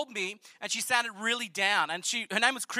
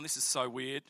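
much lower and softer, under his breath; muttered to himself; 9 semitones lower, 8 dB quieter than usual. Retold or imitated. Alone after hanging up the phone, muttering in disbelief. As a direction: say it to yourself, baffled.

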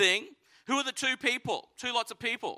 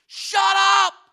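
much higher and louder furious shout; angry shout; 11 semitones higher, 10 dB louder than usual. Retold or imitated. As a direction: bark it at the noisy kids like an indignant priest. Priests angry at the kids.

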